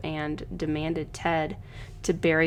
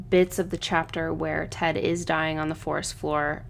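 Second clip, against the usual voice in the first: ordinary talk, nothing marked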